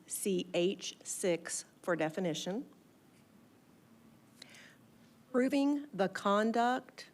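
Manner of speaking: slow dictation with pauses; dictating. Real, not acted. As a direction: read each phrase slowly so they can write. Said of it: "P-, please go slow".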